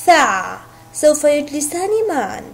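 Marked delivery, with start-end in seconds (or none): 0.1-0.6 ثع said as one joined syllable; syllable demo. Shown as a demonstration. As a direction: give ثع alone, lower and clipped off. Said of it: "لنقطعها معا". (مقطع ثع منطوق وحده)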